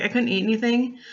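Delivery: ordinary talk, nothing marked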